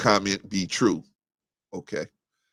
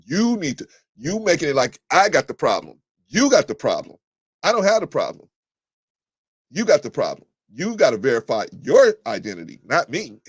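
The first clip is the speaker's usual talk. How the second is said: heated argument; 6 semitones higher, 5 dB louder than usual. Real, not acted. Arguing with a caller.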